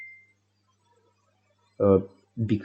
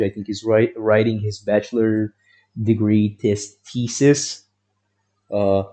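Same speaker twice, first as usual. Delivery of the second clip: normal talking voice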